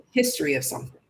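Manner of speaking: normal talking voice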